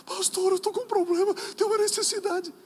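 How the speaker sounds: high, loud plea held on one pitch; imitated needy church member. Church member brings a problem to the pastor, high mock plea. Acts out a member pleading for help.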